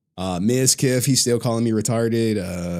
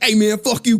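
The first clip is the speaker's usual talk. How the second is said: louder, higher mock insult; mock loud jab; 10 semitones higher, 6 dB louder than usual. Streamer picturing telling off a rival, loud. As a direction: throw it at him like a jab. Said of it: "I never be like".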